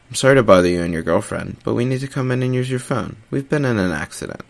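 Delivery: emotionless, forced and dry; lightly voiced, strained, no feeling. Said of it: "His voice sounded forced and dry".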